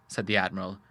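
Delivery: ordinary delivery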